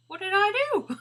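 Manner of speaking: high, loud puzzled dog voice; dog's inner voice. Retold or imitated. Voicing the calm Labrador, innocently puzzled. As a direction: squeak it like an innocent confused dog. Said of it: "this Labrador's just sat there going".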